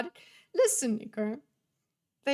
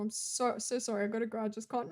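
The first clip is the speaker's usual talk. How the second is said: usual speaking voice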